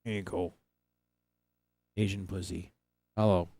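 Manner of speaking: introducing the cat to viewers, hushed; soft pet talk. Real, not acted. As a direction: speak gently so as not to startle it. Softly addressing the cat.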